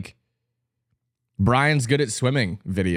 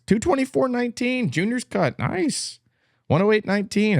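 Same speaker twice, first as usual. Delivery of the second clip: impressed; 11 semitones higher than usual. Real, not acted. Mock impressed reading times.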